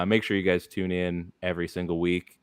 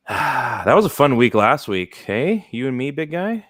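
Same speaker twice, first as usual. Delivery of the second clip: mock sympathy; 6 semitones higher, 5 dB louder than usual. Real, not acted. Teasing friend about bad week.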